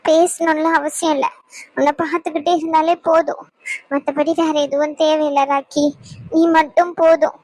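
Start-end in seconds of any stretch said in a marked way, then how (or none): none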